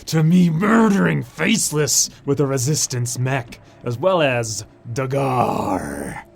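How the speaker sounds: low gravelly-free pirate growl, drawn out. Acted. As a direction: play it like a swaggering pirate captain. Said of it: "I'm getting my pirate voice on".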